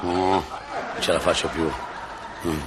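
fading, low, weak voice; dying man. Acted. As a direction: sink the words as if at death's door. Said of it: "un genovese sta morendo".

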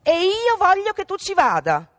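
high, loud insistent mother; insisting mother. A narrator's reading. Mother insisting her rude daughter go, high firm demand. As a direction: lay down the law as the mother. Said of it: "insisté la madre".

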